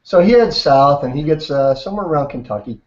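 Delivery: usual speaking voice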